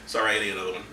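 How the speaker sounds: usual speaking voice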